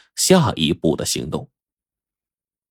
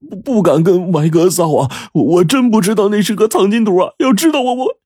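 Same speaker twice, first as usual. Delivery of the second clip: much higher, stammering, frightened underling voice; 13 semitones higher than usual. Acted. Zhang Jun stammers to his boss that he used the map as toilet paper. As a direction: stutter out the confession in a panic.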